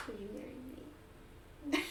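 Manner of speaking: quiet, slow, tender question; soft slow proposal. Retold or imitated. Proposing to her partner, quiet and slow. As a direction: ask it softly, dragging each word.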